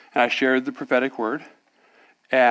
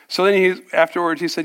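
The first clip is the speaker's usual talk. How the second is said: usual speaking voice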